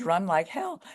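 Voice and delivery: usual speaking voice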